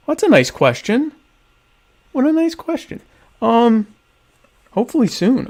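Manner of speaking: high, sugary, delighted; sweet. Real, not acted. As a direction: coo it sweetly. Sweet response to viewer.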